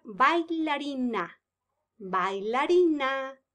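slow, stretched bailarina; syllable by syllable. Shown as a demonstration. Teacher introducing the dancer, sounding it out. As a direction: break it into syllables. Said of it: "teacher sounds out word".

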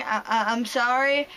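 ordinary talk

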